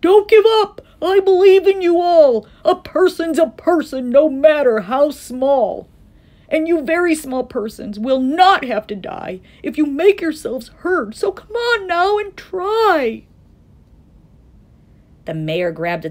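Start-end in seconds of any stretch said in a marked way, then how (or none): 0.0-5.9 loud, high, impassioned cry; rally them with all your might; calling out. A narrator's reading. Captured Horton calls encouragement to the mayor, shouting. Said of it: "he managed to call to the mayor". 6.5-13.2 high, urgent encouragement; calling out. A narrator's reading. Captured Horton urges the Whos to try, calling out. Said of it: "he managed to call to the mayor".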